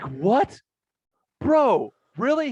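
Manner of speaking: a retold jaw-drop, bro; stunned disbelief. Told rival admitted being wrong.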